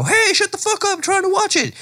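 high-pitched irritated audience yell; annoyed heckler. Acted. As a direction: yell at noisy people around you. Acted annoyed audience member yelling.

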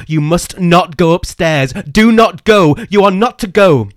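high, loud, slow desperate command; frantic shouting. Acted. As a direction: yell the warning at full force. Colin shouting warning.

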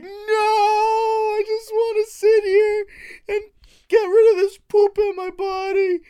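drawn-out, loud falsetto wail; comic wailing, stretched slow and high; high-pitched mock protest. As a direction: plead in a very high, exaggerated whine. Acts out pleading with own thoughts.

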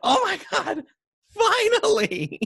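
high, slow thrilled squeal; overjoyed gasp. Retold or imitated. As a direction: squeal it with delight, drawn out. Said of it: "they're like".

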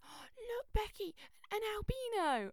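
high, loud excited pointing out; excited gasp. Retold or imitated. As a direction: squeal it like spotting something rare. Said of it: "they went".

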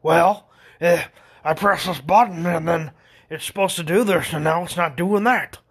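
mock an exasperated customer explaining a fault; complaining older customer. Customer explains the broken button to the expert, griping.

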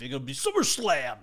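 a hint of a wrestling announcer; network promo voice. Shown as a demonstration. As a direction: lightly hype the event name. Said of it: "You didn't have enough oomph".

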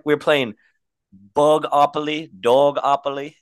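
hammed-up British, pitch often lifted; British accent. Host describing childhood Monopoly, mock British accent. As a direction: tell it in put-on British. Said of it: "we have to talk in a British accent".

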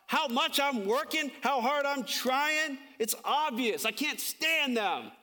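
high, drawn-out mock woe; acted self-pity. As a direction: whine it slowly, pleading how wronged you are. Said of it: "Or the self-pity that I can get".

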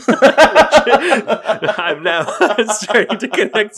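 laughing through the words; giggling mid-sentence, high. Real, not acted. Laughing while realizing something.

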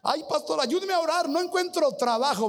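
hammed-up plea for prayer and work; pleading jobless man. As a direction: beg the pastor like a helpless drifter. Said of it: "congregant pleads with pastor".

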